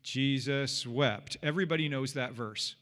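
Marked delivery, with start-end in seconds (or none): 0.0-1.4 Jesus wept drawn out very slowly, word by word; slow recitation. Shown as a demonstration. Preacher leading the congregation in reciting, slow. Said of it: "Say it with me".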